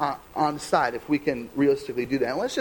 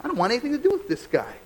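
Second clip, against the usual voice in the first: dismissive scoff; 8 semitones higher than usual. Retold or imitated. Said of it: "they leave saying".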